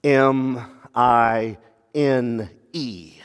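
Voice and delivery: each letter slow and low, spelled out; spelled out slowly. Shown as a demonstration. Preacher spells out the toxic word to the church, slow and low. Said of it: "four-letter word that is so toxic".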